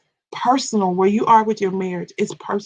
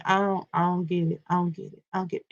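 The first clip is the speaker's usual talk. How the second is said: much softer, fading mock confusion; mock confused mumble; 8 dB quieter, faster than usual. Imitating people who don't get it, fading softly. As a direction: mumble it like they're lost. Acted quote of people not understanding.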